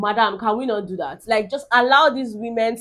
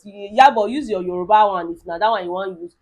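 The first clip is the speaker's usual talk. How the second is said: usual speaking voice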